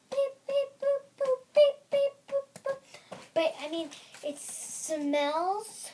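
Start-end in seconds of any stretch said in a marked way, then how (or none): 0.1-2.8 high beep boop noises; robot beeps. Pretend. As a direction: chirp beep boop like a toy.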